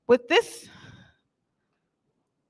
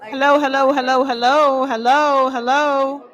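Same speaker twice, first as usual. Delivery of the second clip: mic check calling; 6 dB louder than usual. Real, not acted.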